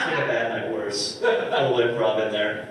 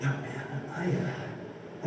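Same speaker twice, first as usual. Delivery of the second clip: subdued reply; 12 dB quieter, much slower than usual. Retold or imitated. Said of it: "And he's like".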